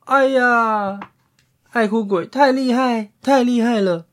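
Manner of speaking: high, loud startled exclaim; loud high surprise. Acted. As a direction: blurt it out amazed. Gray pig shocked, apologizing.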